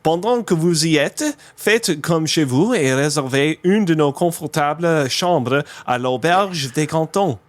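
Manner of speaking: French label read aloud. Real, not acted. Podcaster reads a beer label all in French, French pronunciation. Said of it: "this is all in French".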